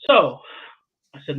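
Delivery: ordinary delivery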